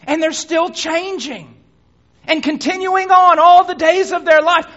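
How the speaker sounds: high, loud climax; preaching shout. Acted. Preacher to congregation, rising shout. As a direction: lift it to a shout.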